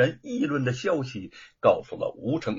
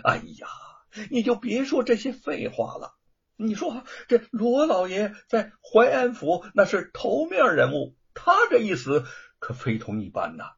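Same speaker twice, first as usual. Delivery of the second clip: hurried, higher and urgent; anxious interruption; 8 semitones higher, 5 dB louder than usual. A narrator's reading. Prefect cuts off the flustered magistrate, anxious. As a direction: cut him off, fretting. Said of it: "着急地打断了他".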